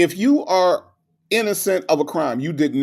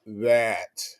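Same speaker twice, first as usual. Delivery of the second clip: usual speaking voice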